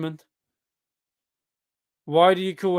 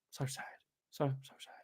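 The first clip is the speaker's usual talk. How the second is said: very soft, low, sad murmur; hushed lament; 4 semitones lower, 19 dB quieter than usual. Real, not acted. Fan lamenting the club's bad season, hushed and sad. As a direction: murmur it mournfully, barely audible.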